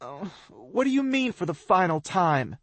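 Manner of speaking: high, upset cry. A narrator's reading. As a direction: plead in disbelief. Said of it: "she cries up to me".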